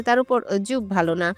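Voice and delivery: usual speaking voice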